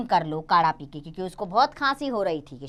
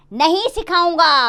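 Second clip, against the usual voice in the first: shouted, higher and louder; shouting; 8 semitones higher, 7 dB louder than usual. Acted. Father shouting at his insistent daughter, loud refusal. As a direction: bellow the refusal. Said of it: "जोर से चिल्लाकर बोलता है".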